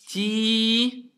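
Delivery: TI with a long, held i; long vowel. Shown as a demonstration. Teacher showing pupils the long vowel, held out. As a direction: stretch the vowel of "ti" on one steady note. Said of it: "c'est la voyelle longue".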